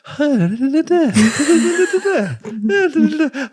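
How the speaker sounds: shrill giggling laugh; high squeaky laugh. Retold or imitated. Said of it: "she goes".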